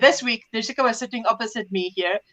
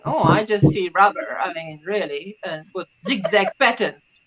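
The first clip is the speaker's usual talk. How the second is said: unimpressed; 6 semitones lower, 4 dB quieter than usual. Retold or imitated. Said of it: "And now you're going".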